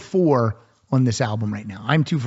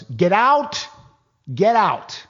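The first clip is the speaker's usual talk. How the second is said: director yelling; 6 semitones higher, much slower than usual. Retold or imitated. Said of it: "Tom Shadyac yells".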